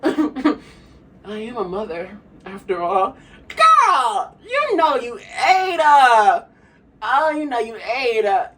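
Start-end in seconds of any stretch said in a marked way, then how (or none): 3.5-8.5 high loud slow squeal of praise; excited hype. Real, not acted. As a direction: scream praise, laughing. Hyped praise of Keke.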